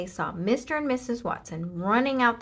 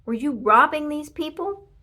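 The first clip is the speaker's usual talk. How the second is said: stern officer; 7 semitones higher, 8 dB louder than usual. A narrator's reading. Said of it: "Officer Tomilello asked".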